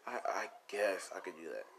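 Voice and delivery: whispered, giving in after a sigh; reluctant whisper. Retold or imitated. Deku agreeing to try a human form, resigned whisper. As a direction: barely voice it, resigned.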